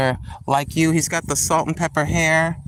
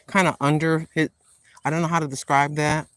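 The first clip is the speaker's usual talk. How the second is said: ordinary talk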